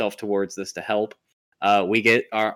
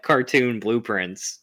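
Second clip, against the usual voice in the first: ordinary talk, nothing marked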